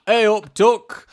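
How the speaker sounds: put-on hammy Yorkshire accent. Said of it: "Do a Yorkshire accent".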